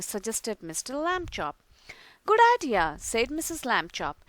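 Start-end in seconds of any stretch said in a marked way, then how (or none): 2.2-3.0 eager agreement. A narrator's reading. Mother agrees brightly.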